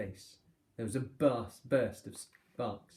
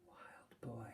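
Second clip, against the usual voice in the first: breathy whispered repeat, much softer; 14 dB quieter than usual. Acted. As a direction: barely breathe the name. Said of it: "repeated the name softly".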